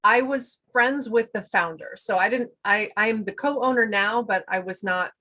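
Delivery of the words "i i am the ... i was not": ordinary delivery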